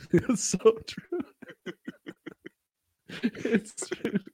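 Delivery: high, slow laughing agreement; giggling through the words. Real, not acted. Laughing at alpha male claim.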